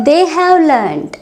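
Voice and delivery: they have learned drawn out slowly for learners; deliberate teacher pacing; slow model phrase. Shown as a demonstration. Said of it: "teacher models then repeats".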